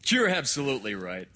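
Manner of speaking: loud, high, hearty doctor; hearty concession. Acted. Doctor agreeing with the objecting woman, loudly hearty.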